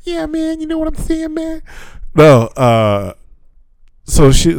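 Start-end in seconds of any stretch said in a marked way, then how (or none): 0.0-1.6 high, soft mock Tyson voice; Tyson impression. Joking back at his friend in a mocking Tyson voice. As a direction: tease him with a squeaky imitation of Tyson. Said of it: "'Cause I sound like Tyson".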